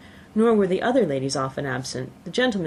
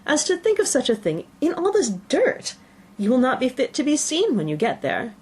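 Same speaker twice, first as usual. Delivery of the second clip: mother crying out, higher and exclaiming; 8 semitones higher than usual. Acted. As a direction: cry out in scolding disbelief. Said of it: "cried her mother".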